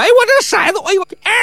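嗓子一下吊得很高，慌了神; 被人一把拎起时的尖声惊叫; 惊慌尖叫. Acted. 囔鼻子被拎起惊叫. (被拎起来的赌徒慌乱尖叫)

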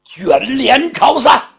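high, loud, slow court shout; drawn-out imperial cry ending the audience; emperor's shout. Acted. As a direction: call the court dismissed like an emperor. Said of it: "杨广一声喝喊".

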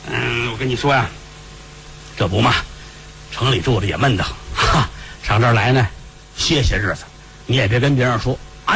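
low elder's voice; confiding old master. Acted. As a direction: drop the pitch to confide in a servant. Master confides in grave keeper.

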